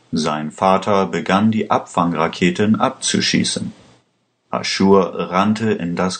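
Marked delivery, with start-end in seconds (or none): none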